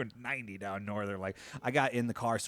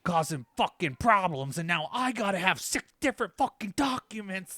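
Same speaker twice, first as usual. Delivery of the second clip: raised, exasperated rant; retold rant; 7 semitones higher, 4 dB louder than usual. Ranting to his wife about the paperwork, exasperated. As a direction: vent at the paperwork.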